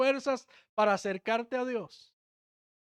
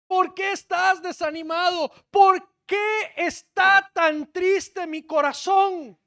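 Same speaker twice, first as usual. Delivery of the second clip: slow, higher, loud shouted question; loud lament; 6 semitones higher, 8 dB louder, slower than usual. Acted. Preacher crying David's psalm to the church, loud and slow. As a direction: hurl the question out in anguish.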